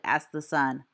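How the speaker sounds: usual speaking voice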